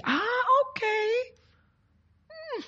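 high, soft 'ah, okay' of realization; mock musing in a high soft voice; pensive realization. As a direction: act out being quietly won over, high-pitched. Acting out impressed learners.

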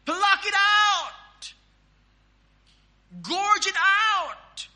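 loud, high, drawn-out cry; forceful preached command. Acted. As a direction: slowly shout it like an urgent command. Shouting out the scripture command.

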